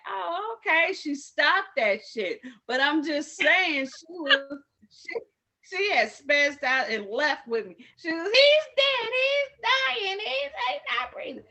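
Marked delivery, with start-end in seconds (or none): 0.1-0.9 high, surprised little oh then okay; mock sudden calm. The screaming lady, suddenly calmed, high surprised okay. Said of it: "she kinda like". 8.2-11.4 high, loud, frantic wailing; mock hysterical scream. The lady screaming at the accident scene, loud and frantic. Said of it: "She just was screaming it".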